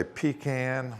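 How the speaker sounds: "pecan" as PEE-kan; variant pronunciation. Shown as a demonstration. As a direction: say "pecan" with a long pee, then kan like can. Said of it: "some people say".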